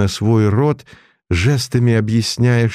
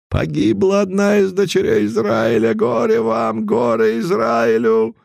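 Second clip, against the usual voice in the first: very high, keening lament; wailing lament; 13 semitones higher than usual. A narrator's reading. Mordukhai laments a lost daughter of Israel, wailing high. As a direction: mourn like a prophet.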